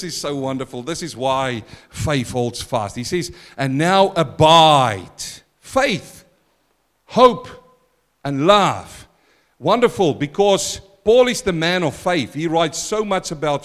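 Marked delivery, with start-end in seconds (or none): 3.6-9.1 loud, slow, each word hammered; emphatic scripture. Acted. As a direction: proclaim each word alone. Declaims scripture.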